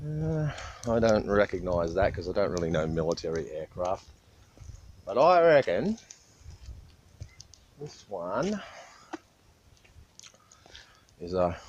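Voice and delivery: talking while chewing gum; words round a wad of gum. Real, not acted. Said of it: "sorry if I'm chewing chewing gum".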